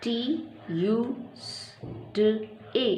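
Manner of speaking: टी, यू, एस, टी, ए spoken slowly one by one; teacher spelling out a weekday; spelling letter by letter. Shown as a demonstration. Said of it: "सही pronunciation है इसका".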